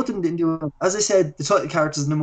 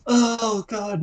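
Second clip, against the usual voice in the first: higher-pitched imitated moan, tailing off; mock distress; 6 semitones higher than usual.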